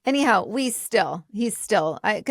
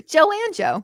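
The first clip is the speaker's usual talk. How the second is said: laughing; 8 semitones higher than usual. Real, not acted. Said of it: "do you know Joanne Joe".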